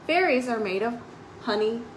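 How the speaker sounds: ordinary delivery, nothing marked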